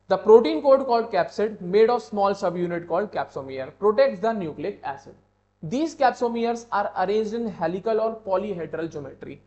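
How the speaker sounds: slow, loud dictation from notes; deliberate, projected classroom reading; textbook read-aloud. Real, not acted. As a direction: read it out emphatically, word by word. Reads textbook lines aloud.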